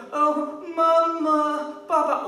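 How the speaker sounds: high, loud mock wail for parents; imitated crying child. Father imitates his second child at night, mock crying. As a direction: imitate a child calling out in tears. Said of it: "一哭叫".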